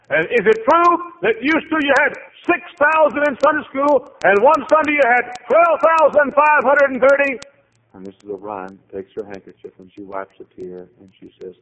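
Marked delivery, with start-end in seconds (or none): 0.1-7.4 high, loud, excited; eager questioning. Retold or imitated. Visitor quizzes receptionist about glory days, high and excited. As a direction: pepper her with eager questions.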